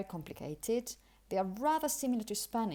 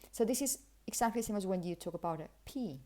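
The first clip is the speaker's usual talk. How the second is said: usual speaking voice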